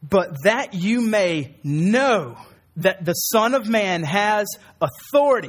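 slow, weighty reading of the verse; slow scripture reading. Shown as a demonstration. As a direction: lay each word down slowly and solemnly. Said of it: "This is what he says".

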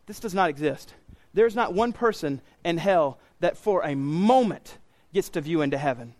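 ordinary talk

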